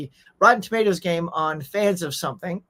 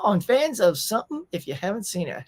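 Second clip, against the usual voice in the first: ordinary talk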